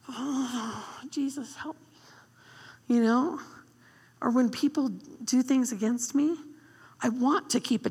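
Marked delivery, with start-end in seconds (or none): 0.1-3.0 soft, slow sighing plea; weary prayer. Retold or imitated. Tired mom praying over her kids, soft slow plea. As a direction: murmur it like a worn-out parent. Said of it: "I'm like".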